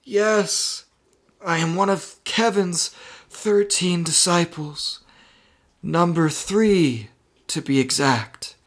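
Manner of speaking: put-on high, measured old being; mysterious old voice. Itelio confiding in Marty telepathically, old person voice. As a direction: explain patiently as an aged telepathic helper.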